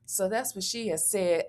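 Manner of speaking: usual speaking voice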